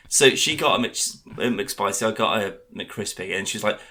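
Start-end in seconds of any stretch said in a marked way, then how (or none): none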